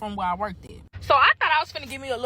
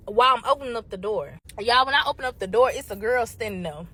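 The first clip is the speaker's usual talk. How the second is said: usual speaking voice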